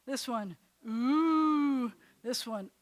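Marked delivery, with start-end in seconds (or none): 0.9-1.9 a long, loud, rounded "Ooh" pitched up; ooh vowel. Shown as a demonstration. Teacher demonstrating the vowel for students, loud round "Ooh". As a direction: hold "Ooh" out for the class. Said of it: "quiz, go".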